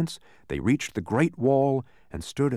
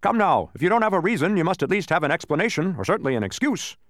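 high, insistent cutting-in; impatient official starting loud, easing off; interrupting; 6 semitones higher, 4 dB louder, faster than usual. A narrator's reading. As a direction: break in and press for an answer. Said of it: "interrupted the gateman".

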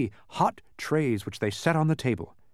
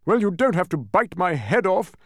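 loud, higher frightened protest; terrified scream; 4 semitones higher, 6 dB louder than usual. A narrator's reading. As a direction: protest loudly, rattled and indignant. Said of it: "screamed the terrified earl".

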